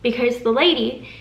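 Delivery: normal talking voice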